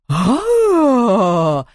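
loud high ghostly shriek; shrieking scream. A narrator's reading. The baroness's head shrieks at Hugo, loud and high. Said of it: "заверещала голова".

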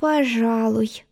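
slow, soft and hesitant; unsure. Acted. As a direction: hedge it, not sure at all. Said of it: "неуверенно подтвердила".

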